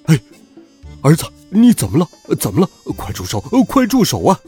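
frantic dad yelling stop; shouting in alarm. A narrator's reading. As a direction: call out to stop your son. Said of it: "爸爸在我口袋里面喊道".

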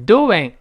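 "Doing" with a w glide in the middle, "do-wing"; inserted w sound. Shown as a demonstration. Teacher shows the glide in "Doing", w inserted. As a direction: slip a w between the vowels of "Doing". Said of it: "中间加一个我这个声音".

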